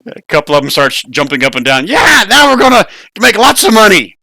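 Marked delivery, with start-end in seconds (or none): 1.8-4.2 high, loud goblin cheering; excited goblins. Acted. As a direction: goblins jump around cheering Splug.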